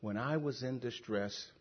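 very hushed, low questions with long pauses; humble questioning. Retold or imitated. Preacher reading the psalmist's questions to God, hushed and humble. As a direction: let each question fade almost to nothing. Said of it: "I think he asked like that".

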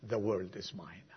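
"The world is mine" whispered, unvoiced and soft; whispered end of the refrain.

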